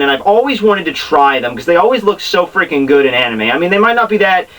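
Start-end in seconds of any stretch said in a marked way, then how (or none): none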